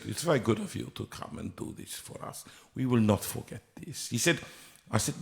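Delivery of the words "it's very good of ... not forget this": hushed mock Russian accent; lightly imitating a Russian accent, much softer; casting director thanks him in a soft Russian accent. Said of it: "suddenly become Russian".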